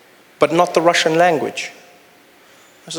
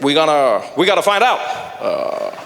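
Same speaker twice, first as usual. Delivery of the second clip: forceful presidential impression with halting pauses; mock-stern statesman, filling pauses with uh; Barack Obama. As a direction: imitate a halting, resolute president. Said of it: "Barack Obama, he was there, he's like".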